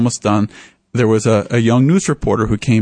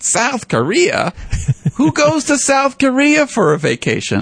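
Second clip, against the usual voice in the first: retold disbelief with a chuckle; incredulous; 13 semitones higher than usual. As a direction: act amazed anyone would go there. Sherwood incredulous at the destination.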